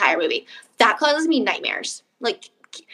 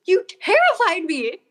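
shaken; 9 semitones higher than usual. Retold or imitated. Scolding boyfriend after scare.

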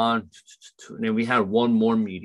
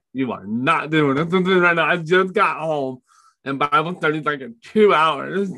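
exasperated protest; 6 semitones higher, 4 dB louder than usual. Retold or imitated. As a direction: whine back at God that you just got home. Protesting to the Lord, exhausted.

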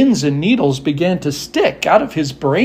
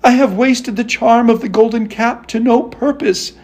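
higher-pitched, understated dismay; a touch of upset in a raised voice; disappointed Dorothy; 7 semitones higher than usual. A narrator's reading. Dorothy after the monkeys leave, mildly tearful disappointment. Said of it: "ready to cry with disappointment".

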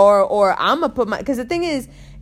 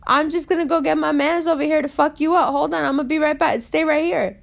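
furious threat; 6 semitones higher than usual. Retold or imitated. [name] telling the groper she'll get her friends, angry and high. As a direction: threaten him, fired up and pitched high. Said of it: "I was like".

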